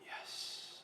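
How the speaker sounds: a soft unvoiced victory whisper; whispered yes. Nanny rejoicing the boy fell asleep, whispered yes. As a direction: hiss it out in secret relief. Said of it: "I was like".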